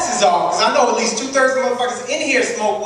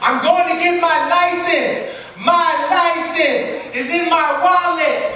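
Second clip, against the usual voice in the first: loud, slow, high and pleading; panicked surrender shout; 9 semitones higher, slower than usual. Acted. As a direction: shout every move to the cop. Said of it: "I talk really loud".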